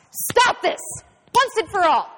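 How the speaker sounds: very high, loud outburst; furious shout. A narrator's reading. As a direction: bellow the order in a rage. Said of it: "The inspector, furious, shouts".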